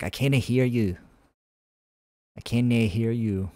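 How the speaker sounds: imitated Celtic-accented grandma who can't hear; grandma's accent, Irish or Scottish. Streamer imitating his hard-of-hearing grandma, Irish or Scottish accent. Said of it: "my grandma's hard of hearing".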